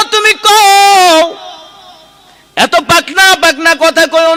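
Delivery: high, loud sermon cry; impassioned waz delivery with stretched endings; full-force preaching. Acted.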